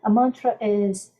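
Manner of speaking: normal talking voice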